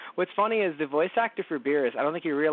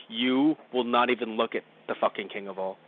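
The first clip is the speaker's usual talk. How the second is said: low-pitched, forbidding guard voice; mock-solemn bodyguard, pitched down; stern guards; 6 semitones lower than usual. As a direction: warn them off in a lowered voice. Acting the stern royal guards.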